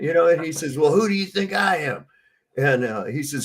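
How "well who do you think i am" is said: retold shout. Sergeant demanding in story.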